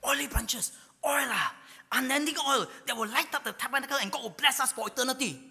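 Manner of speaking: as if hearing the promise of oil. Retold or imitated. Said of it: "they felt how I felt".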